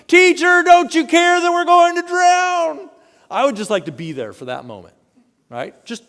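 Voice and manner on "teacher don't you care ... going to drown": high, loud desperate cry over the storm; panicked shout. Acted. Disciples shouting at sleeping Jesus, high loud panicked cry. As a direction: yell it like you're about to drown. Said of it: "woke him up shouting".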